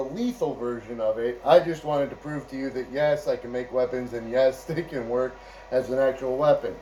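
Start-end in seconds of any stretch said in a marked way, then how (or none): none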